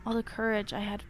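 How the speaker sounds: low, hushed horror storytelling; story narration. A narrator's reading. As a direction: read it low and quiet, drawing us in. Reading horror story aloud.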